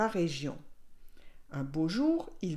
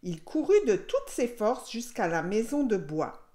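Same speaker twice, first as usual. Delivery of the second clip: plain reading voice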